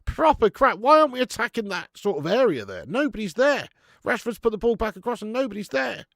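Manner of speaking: indignant fan protest; frustrated rant. Real, not acted. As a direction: complain hard at the team, pitch up. Ranting at England's attack.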